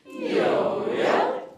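loud high swoop up and down; swooping yo-yo pitch. Shown as a demonstration. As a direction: let the voice drop and spring back. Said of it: "And again?".

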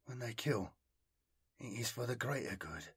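very soft, hushed realisation; inward thought. A narrator's reading. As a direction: murmur it as a private, stunned thought. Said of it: "he thought again".

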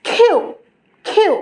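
"কিউ" opening on a puffed kh; correct aspirated Q. Shown as a demonstration. Teacher models correct Q, aspirated "কিউ". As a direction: push air through the k of "কিউ". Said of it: "আমরা correctly".

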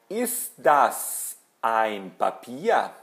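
very slow, each word separated; deliberate teacher's question for learners; model question. Shown as a demonstration. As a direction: ask it word by word, swooping up then down on "Papier". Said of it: "Eu vou perguntar".

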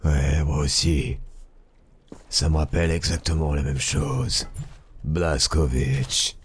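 low, gruff American action hero; a hint of a gravel-voiced game hero; deep video game hero voice. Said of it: "une voix de héros de jeu vidéo américain".

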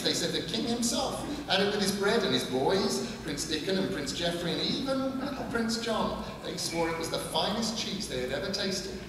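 a hint of a brisk old countrywoman, bragging; lightly voiced old woman. A narrator's reading. Gran boasting to the boy about her cheese, lightly voiced old woman.

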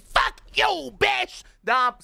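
high, loud cursing yell; a furious shout at the other player; angry shout. Real, not acted. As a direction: bark it at the enemy. Shouting at the target.

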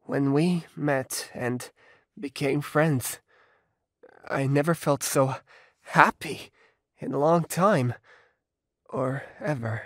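low, halting, sorrowful; heavy-hearted confession. Acted. Richie recalling freshman pain to partner, heavy-hearted.

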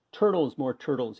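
loud kingly demand; bossy king calling out loudly; bellowing. A narrator's reading. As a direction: bellow the order across the pond. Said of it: "he bellowed and brayed".

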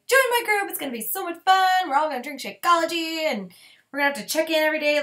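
mock hyped pitch. A coach mocking pushy recruiting posts, hyped bubbly pitch. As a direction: ham up a peppy coach selling hard. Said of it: "than being like".

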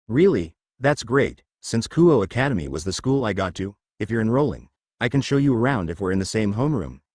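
ordinary delivery, nothing marked

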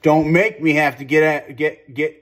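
loud, half-joking threat; mock shouted threat. Mock threat while gaming.

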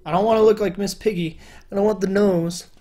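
normal talking voice

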